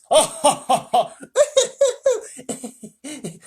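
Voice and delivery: high, loud character laugh; roar of laughter. Acted. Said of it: "a roar of laughter".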